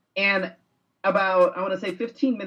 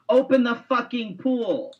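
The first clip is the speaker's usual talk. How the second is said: mild, higher-pitched mock yell; imitated kid's yell; 6 semitones higher than usual. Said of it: "the little kid says, or yells".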